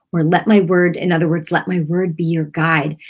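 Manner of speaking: ordinary delivery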